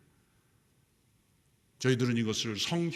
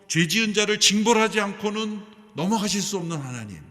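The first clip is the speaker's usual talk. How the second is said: higher and louder, driving each phrase; fervent preaching; 8 semitones higher, 10 dB louder than usual. Acted. Pastor urging the church to see God's grace and truth, loud and high. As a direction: preach it with full conviction.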